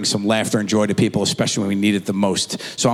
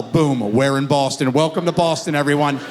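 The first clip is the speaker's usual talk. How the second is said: ordinary talk, nothing marked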